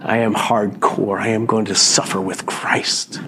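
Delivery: a hint of stubborn martyr, whisper-shouted; mock hardcore refusal. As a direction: ham up a defiant vow in a harsh whisper. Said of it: "I'm like".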